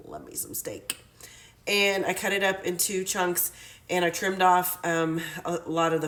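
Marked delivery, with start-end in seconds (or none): 0.1-1.0 whispered aside. Real, not acted. Aside about loving steak.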